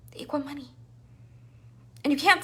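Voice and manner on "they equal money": whispered, They equal money. Real, not acted. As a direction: say it in a secretive whisper. Whispered aside.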